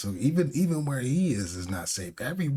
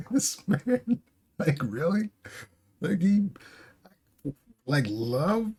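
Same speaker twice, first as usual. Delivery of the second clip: high laughing disbelief; incredulous laughing; 8 semitones higher than usual. Real, not acted.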